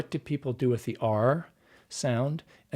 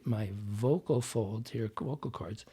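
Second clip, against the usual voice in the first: ordinary talk, nothing marked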